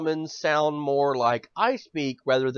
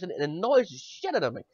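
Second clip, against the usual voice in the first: ordinary talk, nothing marked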